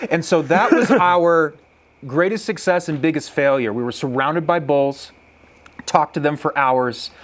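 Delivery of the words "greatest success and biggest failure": ordinary delivery, nothing marked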